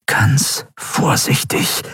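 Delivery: slow, tense whisper; captain whispering to herself while welding. A narrator's reading. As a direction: breathe it out, barely a whisper.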